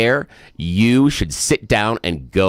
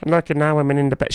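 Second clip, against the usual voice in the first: quick, higher mock voice; fast sing-song parody of a spokesperson; mocking quote; 6 semitones higher, faster than usual. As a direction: parody her claim breezily. Mocking the press secretary.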